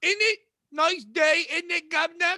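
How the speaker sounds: hammed-up posh English accent, high; mock a prim English gent in an English accent. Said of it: "Have you ever met the English?".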